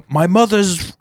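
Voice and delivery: put-on British accent. Quoting a girl's Instagram bit, imitated British accent. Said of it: "she was, like, British or something".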